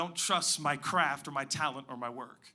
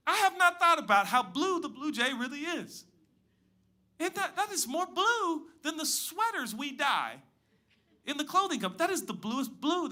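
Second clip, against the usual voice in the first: amazed; 11 semitones higher than usual. Pretend. Marveling at the blue jay.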